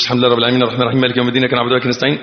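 الحمد لله رب العالمين run together quickly with no pauses; rushed recitation. Shown as a demonstration. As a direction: gabble through the verses. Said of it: "ما تقولش".

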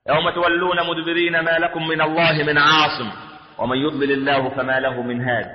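slow, even-toned chanted verse; Quranic recitation. Acted. Preacher reciting to the congregation, slow Quranic chant.

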